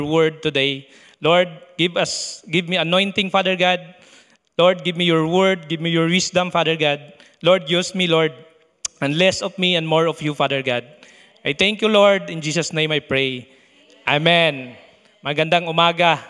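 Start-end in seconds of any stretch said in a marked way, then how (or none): none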